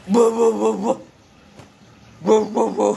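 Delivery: loud punchy woofs; playful mock dog bark; imitated barking. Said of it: "Tarik dulu kakinya".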